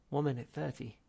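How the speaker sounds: usual speaking voice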